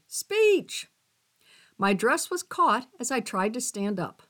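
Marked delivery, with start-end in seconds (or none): 0.1-0.9 high-pitched crowd call for a speech; crowd demand. A narrator's reading. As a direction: call it out like a party guest, high and drawn. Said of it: "then I heard that dreaded word".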